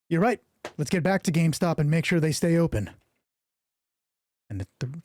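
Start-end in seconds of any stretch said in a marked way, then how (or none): 0.1-2.9 loud, high, eager reply; eager soldier. Acted. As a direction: agree with eager zeal.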